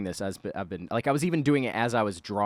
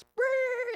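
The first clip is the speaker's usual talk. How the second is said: high trilled engine brrr; imitated truck noise. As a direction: roll out a revving getaway sound.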